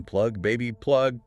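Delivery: lightly shouted quote, pitch rising; half-hearted shout of the slogan; mock shout. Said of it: "A refined French intellectual shouting".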